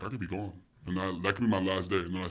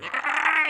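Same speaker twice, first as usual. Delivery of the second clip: high, loud joyful cry; delighted squeal; 6 dB louder than usual. Retold or imitated. Parent seeing son run into the room, delighted squeal. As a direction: squeal as if melting at the sight.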